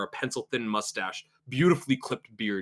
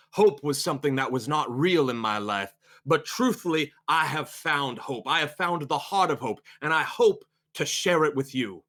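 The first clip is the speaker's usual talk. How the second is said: hopeful preacher; 4 semitones higher than usual. Acted. Preacher telling his congregation he found hope, orating. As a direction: testify to the congregation with swelling conviction.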